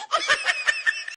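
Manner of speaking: mocking laugh at the daughter-in-law. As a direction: cackle at her.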